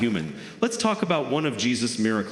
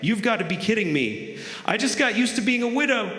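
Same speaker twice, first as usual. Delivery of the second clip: high, fast disbelieving squeal; a widow dismayed her husband is back; mock wife; 8 semitones higher than usual. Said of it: "His wife's probably standing there like".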